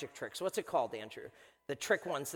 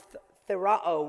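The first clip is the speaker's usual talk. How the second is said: usual speaking voice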